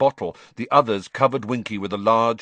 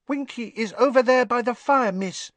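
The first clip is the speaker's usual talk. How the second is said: high-pitched little elf voice; small, high house-elf voice; quiet elf. A narrator's reading. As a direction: tell it in a high elfin pipe. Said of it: "said Dobby quietly".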